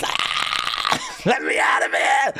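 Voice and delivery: comic scream, then a frantic "let me out of here"; mock panicked yell. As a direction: ham up a tough guy begging to escape. Turns to camera, retold panic.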